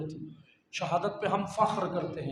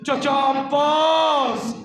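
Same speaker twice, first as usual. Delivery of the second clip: long drawn-out, loud, higher cry; anguished call; 6 semitones higher, 9 dB louder, much slower than usual. Retold or imitated. Preacher voicing a girl crying out to her uncle, anguished call. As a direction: wail it out across a distance.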